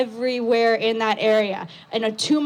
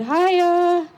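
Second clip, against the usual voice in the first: hesitant stranger; 4 semitones higher than usual. Retold or imitated. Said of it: "He said".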